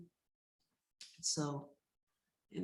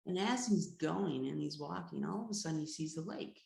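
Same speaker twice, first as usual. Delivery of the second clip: ordinary delivery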